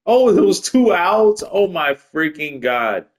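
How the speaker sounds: high, slow, drawn-out disbelief; real dismayed outburst. Real, not acted. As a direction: wail it out slowly at your own blunder. Realizes his blunder in the game.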